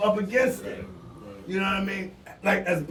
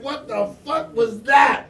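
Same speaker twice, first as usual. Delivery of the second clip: slow, louder shocked cry; stunned loud outburst; 4 semitones higher, 5 dB louder, slower than usual. Retold or imitated. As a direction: drag it out, still reeling from the kick. Stunned after being kicked.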